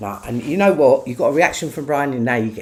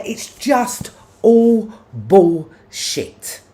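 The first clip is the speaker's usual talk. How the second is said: very slow, louder, dragged out; drawn-out disgust; 7 semitones higher, 5 dB louder, much slower than usual. Real, not acted. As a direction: stretch every word in contempt. Angry rant, dragged out words.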